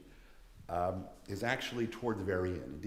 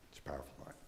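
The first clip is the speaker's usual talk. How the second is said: hushed whisper; 5 semitones lower, 11 dB quieter, much faster than usual. Real, not acted. Lecturer to students after the reading, in an awed whisper. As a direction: breathe the line in an awed whisper.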